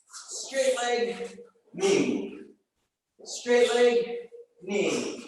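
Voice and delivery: rhythmic workout cue calls; calling cues. Acted. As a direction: call the kicks out in rhythm.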